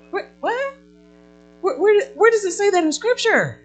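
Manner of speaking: sudden high, loud outraged "What?"; mock disbelief. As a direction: act shocked at the idea, pitching way up.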